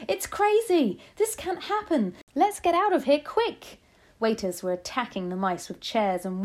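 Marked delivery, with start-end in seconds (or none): 0.1-3.8 high, loud voices of alarm; panicked shouting. A narrator's reading. Diners flee the mice, shouting in panic. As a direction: shout it high, desperate to get out. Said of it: "and shouting".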